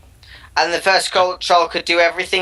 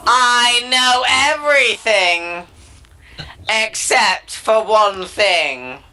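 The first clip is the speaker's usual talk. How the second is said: higher, slow, drawn-out troll voice; slow, higher put-on troll begging the king; pleading troll; 4 semitones higher, much slower than usual. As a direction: stretch every word like a timid suitor.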